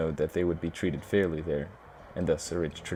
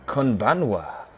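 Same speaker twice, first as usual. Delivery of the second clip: Japanese greeting; 4 semitones higher, 4 dB louder, slower than usual. Acted. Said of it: "I don't know if I did it in the right accent".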